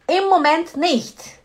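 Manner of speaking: teacher projecting Im Moment nicht for the class to copy; emphatic demo. Shown as a demonstration. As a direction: announce Im Moment nicht loudly and slowly. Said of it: "seria no momento não".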